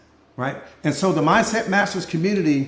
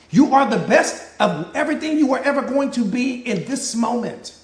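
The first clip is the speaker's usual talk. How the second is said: ordinary delivery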